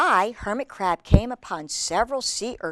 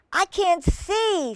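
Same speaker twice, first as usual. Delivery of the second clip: small snail voice; 11 semitones higher, 3 dB louder, slower than usual. Acted. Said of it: "said the snail".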